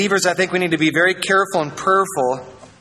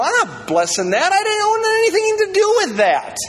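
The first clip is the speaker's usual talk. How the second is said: high-pitched, incredulous mock protest; God refusing indignantly. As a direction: play an affronted God disowning the whole thing. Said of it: "And God's going".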